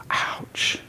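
breathy whispered wince; hushed ouch. Real, not acted. Preacher reacts to Jesus' claim.

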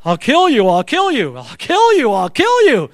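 mounting echo, punched on KILL; threat ringing in head. Retold or imitated. Dad's threat ringing in son's head, mounting echo. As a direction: let the looping threat swell and rise. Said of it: "what started ringing in my ears".

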